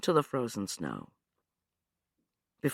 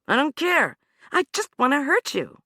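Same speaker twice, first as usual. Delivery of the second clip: high, loud, cruel; mean every word; vicious threat; 13 semitones higher, 5 dB louder than usual. Acted. Anna cold threat.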